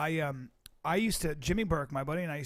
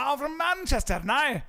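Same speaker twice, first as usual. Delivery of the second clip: loud, high mock Manchester accent; hammed-up Manchester accent; 8 semitones higher, 6 dB louder than usual. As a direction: exaggerate a northern English Manchester accent. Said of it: "I was speaking like".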